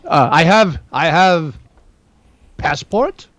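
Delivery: lightly imitated Russian, slow and halting; broken English with a hint of Russian accent; mild Russian accent. Priest speaking broken English, mild mock-Russian accent. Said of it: "doing a Russian, like, a mild Russian accent".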